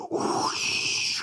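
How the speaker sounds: imitated rushing wind; howling wind whoosh. A narrator's reading. Storyteller imitates the wind at the cave mouth, howling whoosh. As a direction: make a windy, voiceless whoosh. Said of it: "he heard this howling sound".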